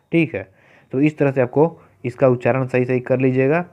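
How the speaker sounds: ordinary talk, nothing marked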